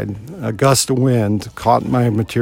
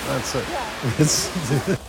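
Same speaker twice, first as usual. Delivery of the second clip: "That's it." repeated much softer, tearful, trailing away; emotional; 4 semitones higher, 8 dB quieter than usual. Real, not acted. Repeating his closing line to the audience, quietly tearful. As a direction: let "That's it." fade out, barely holding back tears. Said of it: "I'm getting emotional".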